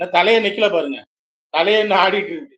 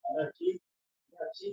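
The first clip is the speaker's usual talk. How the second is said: soft high coo; 11 semitones higher, 16 dB quieter than usual. Real, not acted. Grandparent gently asking the crying baby what's wrong. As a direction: ask gently up high, barely voiced.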